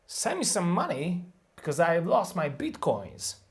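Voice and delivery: "send", "money", "lost" and "bitcoins" punched; content word stress demo. Shown as a demonstration. Teacher models English stress for learners. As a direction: stress only the content words. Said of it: "These are the stressed words".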